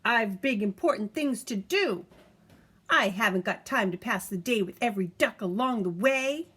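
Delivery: self-important truck yelling to get by; bossy dump truck. Acted. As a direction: push everyone aside, full of yourself. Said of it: "yelled the dump truck".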